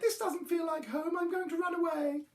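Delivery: high little lament; unhappy youngster voice; cried out. A narrator's reading. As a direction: complain forlornly, up high. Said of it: "he cried".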